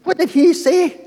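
high, loud disbelieving question; can't believe what he heard; stunned sailor over the storm; incredulous sailor. Acted.

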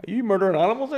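high, loud deadpan ask; playfully voiced phone call; mock official question. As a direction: ask it straight like a council officer. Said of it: "I had to call and be like".

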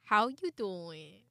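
slow, stretched New York accent. Shown as a demonstration. Co-host trying out a New York greeting, drawn-out New York accent. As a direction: drawl it like an Italian-American New Yorker. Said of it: "that's a good New York accent".

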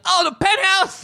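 high-pitched mock dismay; mimicked alarmed cry. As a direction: cry out high, imitating a movie panic. Mimics film character's alarmed cry.

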